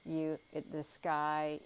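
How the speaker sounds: normal talking voice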